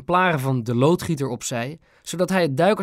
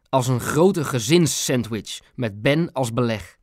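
ordinary delivery, nothing marked

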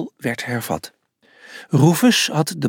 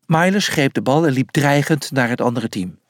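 usual speaking voice

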